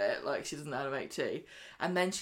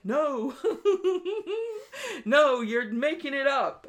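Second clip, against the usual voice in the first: high, louder laughing denial that slows down; nervous laugh; 9 semitones higher, 7 dB louder, slower than usual. Retold or imitated. Stephen laughs off Rufus's accusation, in a high nervous laugh. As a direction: laugh it off, rattled.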